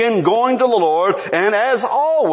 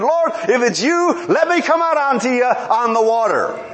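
high, eager plea to walk out; zealous; 6 semitones higher than usual. Acted. Peter asking the Lord to let him come, zealous. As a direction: ask boldly, brimming with excitement. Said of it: "He's very zealous now".

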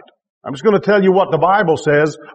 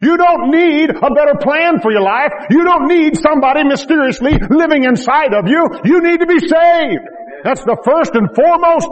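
full-force preaching; 7 semitones higher than usual. Acted. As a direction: drive it home to the congregation.

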